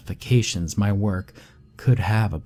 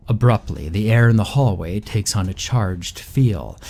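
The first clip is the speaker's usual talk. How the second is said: plain reading voice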